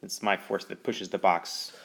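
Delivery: ordinary talk, nothing marked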